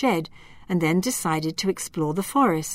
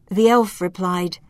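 ordinary delivery

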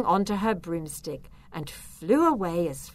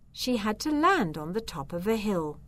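usual speaking voice

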